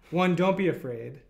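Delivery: usual speaking voice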